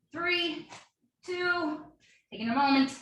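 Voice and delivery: usual speaking voice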